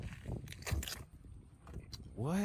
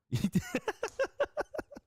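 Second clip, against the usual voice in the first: high laugh. Real, not acted. Reacting to clip.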